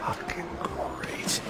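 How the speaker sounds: slow bitter whisper under his breath; whispered curse. Acted. Cornered man whispering a curse to himself. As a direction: hiss it out slowly, barely audible.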